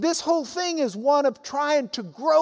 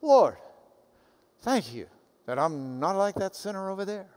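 smug Pharisee; 3 semitones lower, 4 dB quieter than usual. Retold or imitated. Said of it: "the Pharisee says".

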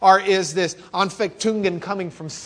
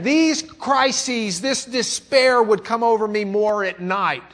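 louder, impassioned troubled confession; Martin Luther's lament; 4 semitones higher, 6 dB louder than usual. Retold or imitated. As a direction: voice Luther's night despair with fervour. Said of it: "He said".

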